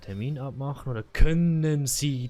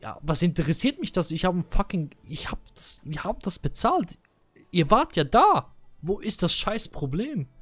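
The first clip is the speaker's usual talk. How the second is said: retold angry outburst; 5 semitones higher than usual. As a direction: let the anger spill out fast. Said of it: "Ich bin komplett ausgeflippt".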